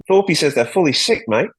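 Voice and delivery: high, punchy mock Australian; Australian accent. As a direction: imitate an Aussie ad catchphrase. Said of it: "That was good".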